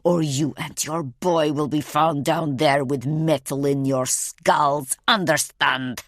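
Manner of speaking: intimidating, controlled menace; menacing hiss. A narrator's reading. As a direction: threaten through a hiss, weighting the last words. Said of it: "he hisses".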